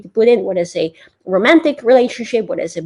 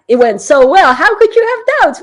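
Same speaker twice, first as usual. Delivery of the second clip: much higher and louder, incredulous; amazed listeners; 9 semitones higher, 9 dB louder than usual. Retold or imitated. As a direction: act out fans who can't believe you doubted. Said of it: "you guys are like".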